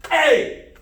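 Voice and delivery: loud, high-pitched strike yell; martial arts kihap. Shown as a demonstration. Instructor repeating the eye gouge drill, kihap shout. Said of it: "Eye gouge and key up".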